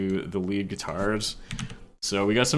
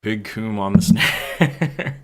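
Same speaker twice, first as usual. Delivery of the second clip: laughing; 5 dB louder, much slower than usual. Real, not acted. Laughing at the snare sound.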